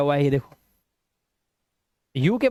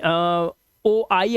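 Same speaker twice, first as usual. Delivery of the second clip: letters named slowly one by one; slow spelling; much slower than usual. Shown as a demonstration. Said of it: "teacher spelling out letters".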